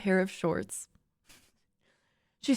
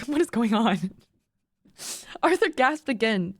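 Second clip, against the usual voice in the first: ordinary delivery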